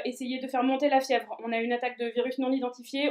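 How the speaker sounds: usual speaking voice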